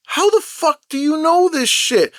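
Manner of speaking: high-pitched incredulous demand; exasperated, squeaky-high retold question; baffled outrage. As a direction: act out total bewilderment, voice way up. Acts out his baffled question to friends.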